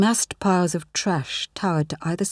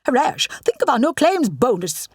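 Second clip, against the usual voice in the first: a hint of a scolding mother, higher; nagging mum; 7 semitones higher than usual. Rani imitates her mum scolding her dad, lightly voiced. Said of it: "sound like my mum".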